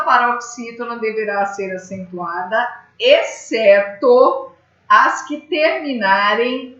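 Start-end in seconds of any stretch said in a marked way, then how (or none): 3.0-6.8 slow emphasis. Shown as a demonstration. Teacher stressing the exception.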